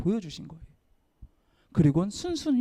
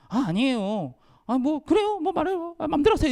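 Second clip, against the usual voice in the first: put-on higher, whiny teen dismissal; sulky teen brush-off; 9 semitones higher than usual. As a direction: shrug it off like a sulky teenager. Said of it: "이렇게 되니까". (높은 목소리로 시큰둥한 학생 흉내)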